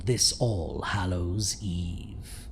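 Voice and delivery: slow, low ghoulish farewell into a laugh; sinister sign-off. Acted. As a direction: drag it out like a gleeful ghoul.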